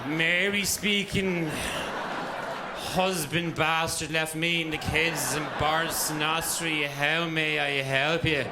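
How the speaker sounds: slow, droning operator greeting; miserable phone operator. Acted. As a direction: drag it out like a weary operator. Acted directory enquiries operator, miserable.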